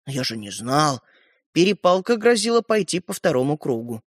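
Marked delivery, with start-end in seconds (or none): 0.1-1.0 low, subdued excuse; sheepish. Acted. Стёпа excusing himself in the quarrel, sheepish. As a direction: back down in a lowered voice. Boy answers objection sheepishly.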